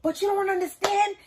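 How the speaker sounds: a hint of the girlfriend's complaint, rising; lightly voiced girlfriend, building to outrage; upset girlfriend. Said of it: "She's like".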